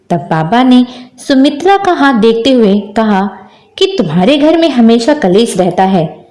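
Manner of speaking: usual speaking voice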